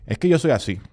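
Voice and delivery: mocking a fixed-mindset excuse; mock stubborn voice. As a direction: play the person who refuses to change. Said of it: "se dice".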